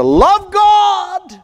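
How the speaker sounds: sermon climax; preaching shout. Real, not acted. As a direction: ring it out to the church.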